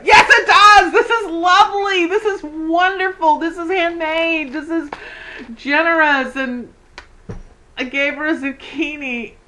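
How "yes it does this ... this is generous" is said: high, louder, laughing insistence, easing off line by line; a real excited outburst that settles down; excited protest. Real, not acted. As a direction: gush over the gift and defend it. Protesting gift worth, laughing.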